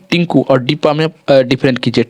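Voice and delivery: normal talking voice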